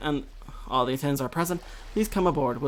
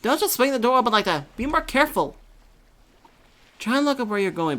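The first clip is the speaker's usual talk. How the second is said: louder, higher scolding; angry scolding; 8 semitones higher, 6 dB louder than usual. Acted. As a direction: tell her off sharply for slamming the door. Acted quarrel over the door.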